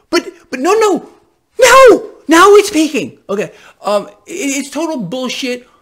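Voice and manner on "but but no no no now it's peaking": mock outrage. Mocking the narrative, escalating.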